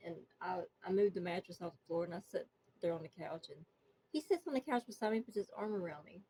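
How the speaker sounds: ordinary delivery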